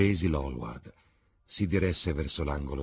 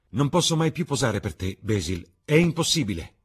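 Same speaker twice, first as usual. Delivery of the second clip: higher, vehement refusal; agitated exclamation; 10 semitones higher, 7 dB louder than usual. A narrator's reading. Dorian refuses to pose for Basil, agitated exclamation. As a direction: exclaim it, recoiling from the request. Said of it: "esclamò Dorian".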